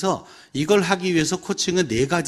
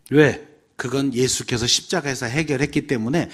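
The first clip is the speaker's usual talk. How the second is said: ordinary talk, nothing marked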